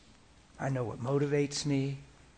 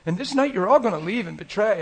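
Jesus hurt and disappointed; 6 semitones higher, 4 dB louder than usual. Retold or imitated. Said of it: "And he says".

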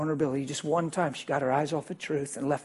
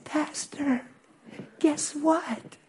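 much higher, bubbly imitation of her; excited woman; 12 semitones higher, much slower than usual. Woman running up to tell pastor news, excited. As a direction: gush it like she can't hold it in.

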